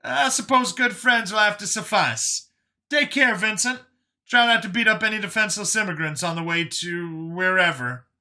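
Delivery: high, animated character voice; wry Brian. A narrator's reading. Brian bids Vincent farewell, wry. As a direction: sign off wryly in a high, punchy voice.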